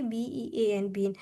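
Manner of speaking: soft, slow spelling of बी ई ए एन, then a long ee in बीन; spelled then said. Shown as a demonstration. Teacher spelling bean for the class, soft and slow. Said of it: "for example in bean".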